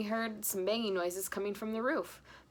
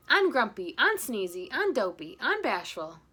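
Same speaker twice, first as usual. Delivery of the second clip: louder commanding call, fading; shouted; 3 semitones higher, 6 dB louder than usual. Acted. Husband calling reindeer names, shouting. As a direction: drive the team. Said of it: "He shouted".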